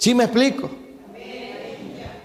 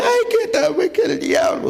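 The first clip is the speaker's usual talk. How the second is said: whiny, much higher mock complaint; mock whining; 13 semitones higher than usual. Preacher mocks a believer blaming the devil, whining high. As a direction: ham up a whimpering excuse. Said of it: "no venga chillando".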